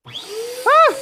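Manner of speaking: sudden high-pitched "ah"; startled shriek. Real, not acted. Donor setting off the balloon, high startled yelp. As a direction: yelp high as if it just popped. Balloon blows up after countdown.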